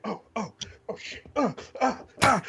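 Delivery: high, strained panicky yelps; imitating a boy caught off guard; mock panic. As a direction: squeak out a scared stammer. Retold slap-boxing panic.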